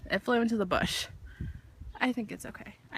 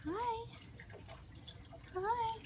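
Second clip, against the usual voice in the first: coaxing a wild bird; 12 semitones higher, 11 dB quieter than usual. Real, not acted. Greeting a rescued bird.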